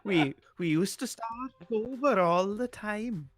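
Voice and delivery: a Welsh voice gone Swedish; hammed-up Welsh accent; Swedish-tinged Welsh. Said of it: "That was very Swedish".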